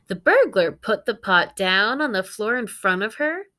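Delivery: lively read-aloud for the class; story narration. A narrator's reading. Teacher reading a picture book to her students, animated storytelling.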